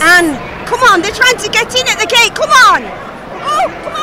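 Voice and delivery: urgent shout. Acted. Urging friend as scabs enter.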